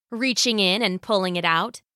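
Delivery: ordinary delivery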